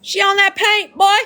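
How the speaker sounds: high-pitched mock holler; playful yell. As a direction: yell it at a random stranger for a laugh. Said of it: "yell at a random person".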